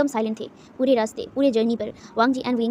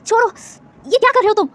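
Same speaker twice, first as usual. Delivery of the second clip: much higher, loud cry of pain; 10 semitones higher, 6 dB louder than usual. A narrator's reading. Ishing cries out as her hand is gripped, pained shriek. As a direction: shriek as your wrist is crushed. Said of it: "issuing दर्द में कराहते हुए कहता है".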